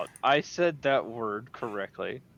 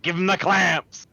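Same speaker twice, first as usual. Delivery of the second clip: high, loud nasal robot shout; 7 semitones higher, 5 dB louder than usual. Acted. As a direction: bark it like a whiny robot. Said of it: "a robot with a nasally voice goes like".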